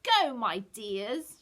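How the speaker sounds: loud, very slow, high screech; witch screech. A narrator's reading. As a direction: drag out the command like a gloating witch. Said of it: "she screeched".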